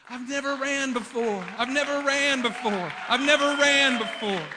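high, loud joyous yelling; overjoyed cry of a healed man; ecstatic shouting. Retold or imitated. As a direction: shout it like you just ran for the first time. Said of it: "running up and down the aisle crying".

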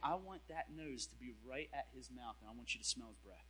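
low quiet divine command; hushed voice of God. Retold or imitated. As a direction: say it as God whispering a firm instruction.

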